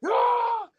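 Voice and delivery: very high shouted roar; hammed-up battle cry; mock roar. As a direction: scream like going Super Saiyan. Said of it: "Braun's just like".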